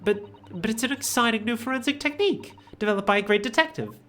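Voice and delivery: eager lawyer. Acted. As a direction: pitch the new technique keenly. Defense lawyer character pleads.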